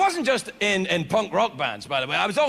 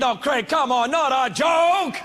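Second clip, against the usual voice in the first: whiny outraged audience voice; mock audience protest; 6 semitones higher, slower than usual. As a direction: play the heckling crowd. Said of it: "I know what you're thinking".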